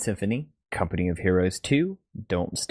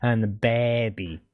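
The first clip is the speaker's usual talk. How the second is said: mock Aussie accent; 3 semitones higher than usual. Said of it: "it sounded way closer".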